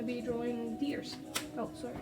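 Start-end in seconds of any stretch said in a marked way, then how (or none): none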